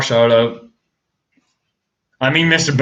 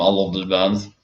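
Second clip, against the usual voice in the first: ordinary talk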